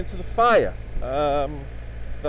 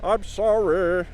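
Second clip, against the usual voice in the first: [name] impression; 5 semitones higher, slower than usual. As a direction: talk like the singer croons, drawn out. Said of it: "I wonder if he talked that at home".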